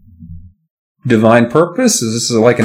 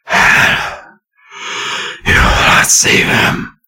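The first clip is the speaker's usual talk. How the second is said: slow, raspy ghostly whisper; raspy reply; much slower than usual. A narrator's reading. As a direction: hiss the threat low and slow. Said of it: "came the raspy reply".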